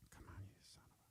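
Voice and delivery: frustrated whisper; whispered curse. Real, not acted. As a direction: curse it under your breath.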